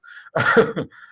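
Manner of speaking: breathy burst of laughter; amused laugh at the story; real laugh. Real, not acted. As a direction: laugh out at the memory. Laugh after quote.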